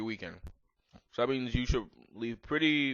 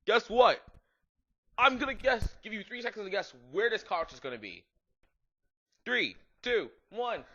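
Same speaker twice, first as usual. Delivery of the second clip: put-on high, eager and pushy, like a giddy goth teen; hammed-up excitement; 9 semitones higher, 3 dB louder than usual. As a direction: build suspense in a silly squeaky voice. Hammed-up excited fanfic character.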